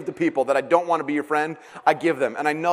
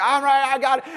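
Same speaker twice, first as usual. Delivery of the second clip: put-on eager kid; 9 semitones higher than usual. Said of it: "he's like".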